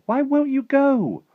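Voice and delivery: very high, slow, loud wail of protest; drawn-out girlish plea; pleading cry. A narrator's reading. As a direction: demand it loudly, pitched right up. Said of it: "Sophie cried".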